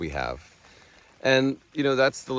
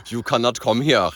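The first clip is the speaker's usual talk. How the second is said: put-on German accent; 4 semitones higher, 8 dB louder than usual. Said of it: "That's a German accent".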